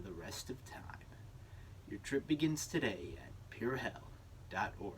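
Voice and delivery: soft, hushed ad read that drops to a whisper; commercial voice-over. Acted. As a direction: sell damnation in an intimate murmur. Reads a hell commercial.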